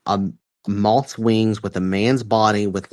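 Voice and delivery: usual speaking voice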